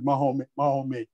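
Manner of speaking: put-on Mahome, the old French form of Muhammad, ending in an open -é. Shown as a demonstration. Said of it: "the French, for example, in the past".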